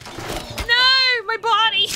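high, loud cry of dismay; anguished yell. Real, not acted. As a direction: yell out in pain. Character being killed in game.

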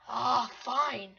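usual speaking voice

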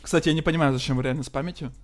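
ordinary delivery

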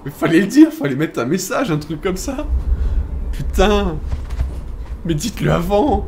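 plainte forte et agacée; râle énervé. Real, not acted. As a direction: engueule le jeu. Râle contre le jeu. (râle énervé)

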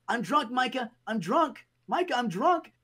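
high, pleading whine; mock whining teammate. Teammate pleading to Micah about his broken gun, high whine. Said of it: "He's like".

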